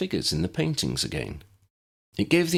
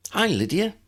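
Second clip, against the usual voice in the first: put-on cheerful girl; 12 semitones higher than usual. Abby greeting cheerfully.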